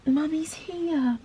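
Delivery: gently calm a trembling dog; soothing. Real, not acted. Soothing her shaking dog.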